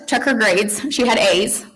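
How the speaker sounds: ordinary talk, nothing marked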